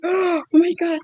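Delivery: a high gasped "Oh my God"; shocked oh my God. Retold or imitated. Retelling her shock at the mother's refusal, surprised.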